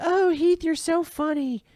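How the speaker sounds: high falsetto mock flirt. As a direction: ham up a fawning flirt. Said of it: "She's like".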